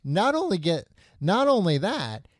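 high-pitched, emphatic amazement; excited reveal. Real, not acted. Storyteller revealing a live toad to co-host, excited.

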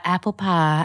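apple pie in a put-on Southern drawl; Southern accent. Shown as a demonstration. Actress showing her pre-show warm-up phrase, Southern accent. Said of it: "P S the Southern accent".

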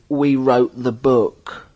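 very slow, self-important quote; slow boast. Retold or imitated. Touring rocker boasting to the folk audience, slow and self-important. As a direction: stretch it out as a mock brag. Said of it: "He said, he said".

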